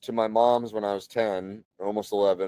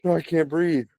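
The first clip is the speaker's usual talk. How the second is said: imitating panicked son; 7 semitones higher than usual. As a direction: whine it like a scared kid. Son panicking, voiced by parent.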